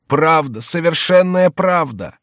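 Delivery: high, loud, drawn-out confirmation; mysterious voice. A narrator's reading. Mysterious voice confirms the confession, slow and emphatic. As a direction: pronounce it as solemn judgement.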